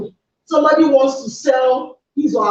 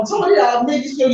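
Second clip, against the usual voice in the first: normal talking voice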